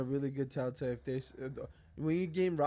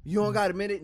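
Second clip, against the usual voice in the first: fast, louder pitch with a pushy edge; pushy street hustler; 5 semitones higher, 5 dB louder than usual. Retold or imitated. Street hustler stopping a passerby, fast pushy ask. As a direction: hustler pressing a stranger for a minute. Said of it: "they'll be like".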